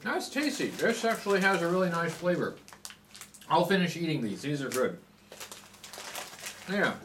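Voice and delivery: muffled between bites; talking while chewing. Real, not acted. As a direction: mouth full of crunchy snack. Seen 1: Puts sticks in mouth at 1.1s, then chews with closed lips.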